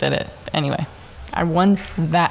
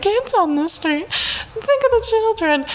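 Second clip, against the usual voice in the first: much higher, slow, pearl-clutching plea; a high, prim "think of the children" wail; prissy suburban mom; slower than usual. Retold or imitated. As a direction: ham up the scandalized Karen. Mocking her own prissy plea.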